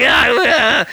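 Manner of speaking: mock whimpering cries; imitated helpless bawling; wimpy wailing. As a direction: whine like a limp crybaby. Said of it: "turned me to a noodle".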